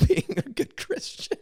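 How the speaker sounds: laughing. Real, not acted. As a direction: laugh it out, barely voiced. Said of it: "I'm such a fraud".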